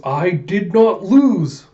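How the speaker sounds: loud, slow, rising-pitch defiance; slow, heated shout; angry denial. A narrator's reading. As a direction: deny it furiously, word by word. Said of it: "Alita's getting mad".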